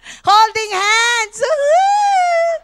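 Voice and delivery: slow, loud, high cry of delight; loud delighted whoop rising high; gleeful whoop. Real, not acted. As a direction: whoop it out with joy. Delighted retelling husband's gesture.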